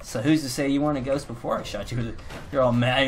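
ordinary talk

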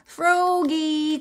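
high, drawn-out call. A narrator's reading. Mother calling Froggy out of pond, high call. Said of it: "called his mother".

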